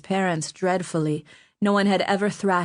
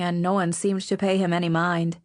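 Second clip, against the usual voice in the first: usual speaking voice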